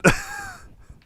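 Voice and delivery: high breathy nervous laugh; a short nervous laugh. Real, not acted. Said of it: "I'm nervous laughing".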